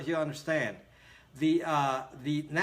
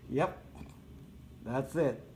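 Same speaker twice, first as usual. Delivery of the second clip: ordinary talk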